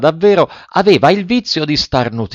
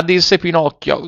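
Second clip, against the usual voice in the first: plain reading voice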